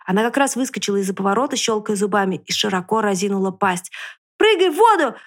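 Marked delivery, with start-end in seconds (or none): 4.4-5.2 громкий высокий крик; крикни другу прыгать. A narrator's reading. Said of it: "крикнул лис поросёнку". (лис громко кричит поросёнку)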